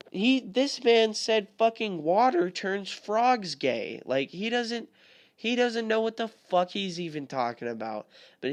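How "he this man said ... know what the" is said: incredulous. Real, not acted. Incredulous about Alex Jones.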